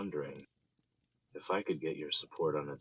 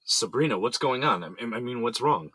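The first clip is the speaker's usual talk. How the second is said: alarmed shout; 7 semitones higher, 10 dB louder than usual. Acted.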